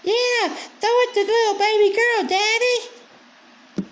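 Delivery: very high, whiny mock baby talk; mock baby girl voice. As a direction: coo it like a spoiled baby girl, hammed up. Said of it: "And she's like".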